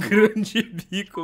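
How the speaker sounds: words stretched out slowly, teasing; very slow jokey emphasis; slow mocking repeat. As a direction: drag it out to milk the joke. Mocking the misheard quiz option.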